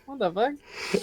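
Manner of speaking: softly tossed-off Southern reply; chill Southern dude. Acted. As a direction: play a mellow Southern dude, quietly baffled. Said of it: "like, super chill southern dudes".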